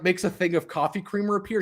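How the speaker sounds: usual speaking voice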